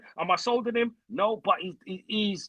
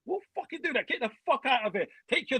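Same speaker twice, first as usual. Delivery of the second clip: excited; 6 semitones higher than usual. Real, not acted. Fan ranting about sacking manager.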